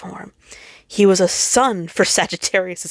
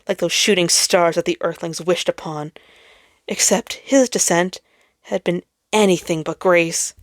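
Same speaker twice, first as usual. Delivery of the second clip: ordinary delivery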